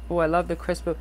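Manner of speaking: usual speaking voice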